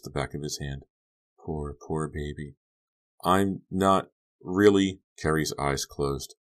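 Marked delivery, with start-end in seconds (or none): 1.4-2.6 slow, soft, drawn-out pity; hushed, unhurried crooning; soft cooing. A narrator's reading. Soothing a drugged, drowsy man. 3.2-5.0 slow, halting, louder protest; drawn-out words, fighting sleep; drowsy protest. A narrator's reading. Drugged man fading into sleep.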